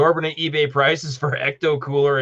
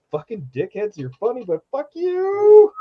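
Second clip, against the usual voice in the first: high squeaky mock-angry voice; high squeaky fake grumble; squeaky mock friend; 12 semitones higher, 5 dB louder than usual. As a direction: squeal it like a flustered friend hiding a laugh. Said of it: "he's like".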